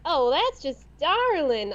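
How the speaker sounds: drawn-out Kentucky drawl; grandma's Kentucky accent. Retold or imitated. Quoting grandma from Kentucky, slow Kentucky accent. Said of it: "she says stuff like".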